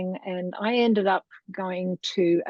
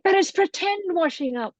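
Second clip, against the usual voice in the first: high, fast scolding teacher; hint of an indignant teacher, higher and faster; exasperated teacher; 11 semitones higher, 3 dB louder than usual. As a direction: rattle it off high like a fed-up teacher. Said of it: "And she said".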